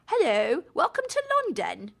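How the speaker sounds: high, prim put-on British hello, rising at the end; a second, posher try at a fake British accent; mock British accent. Birds welcoming her to London in a prim mock British accent. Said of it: "Don't make fun of my accent".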